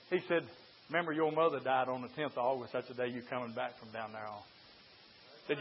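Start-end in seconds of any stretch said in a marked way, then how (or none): 0.9-4.4 low, soft, quick devil voice; devil's taunt. Acted. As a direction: murmur it like the tempter at his ear. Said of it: "And here is the devil".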